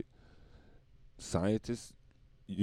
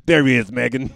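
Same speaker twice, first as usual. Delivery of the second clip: mock announcer call; 4 semitones higher, 12 dB louder than usual. Mock announcing Prince Harry.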